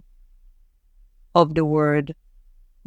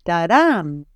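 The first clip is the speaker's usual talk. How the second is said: ta-DAM, beat landing on the last syllable; Hebrew stress rhythm. Shown as a demonstration. Teacher showing the music of Hebrew, final-syllable stress. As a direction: tap out "Ta-dam" with the weight at the end. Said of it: "it goes like this".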